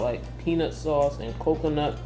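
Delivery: usual speaking voice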